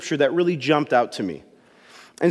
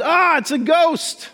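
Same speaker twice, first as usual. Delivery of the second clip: high frightened cry of ghost, drawn out slowly; mock terrified yell; 10 semitones higher, slower than usual. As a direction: yell it like you just saw a ghost. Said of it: "what they yelled was".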